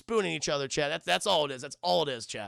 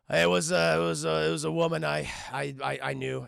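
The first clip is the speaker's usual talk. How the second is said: halting confession; 3 semitones lower, 3 dB louder than usual. Retold or imitated. Voicing Logan's reluctant confession.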